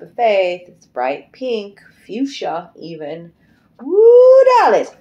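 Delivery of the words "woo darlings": high, loud joyful woo; sudden burst of delight; excited whoop. Real, not acted. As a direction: whoop it out to the darlings. Excited outburst during reading.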